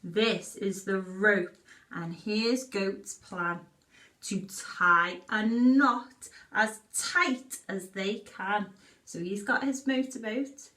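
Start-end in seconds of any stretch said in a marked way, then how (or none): 0.0-8.7 put-on reading a story to children. Reads picture book aloud.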